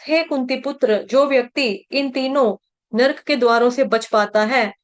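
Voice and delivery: ordinary talk, nothing marked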